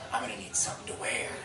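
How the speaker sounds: soft, low, quick villain aside; understated movie villain; Joker. Retold or imitated. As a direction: say it quietly after the carnage. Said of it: "the Joker asks for a glass of water".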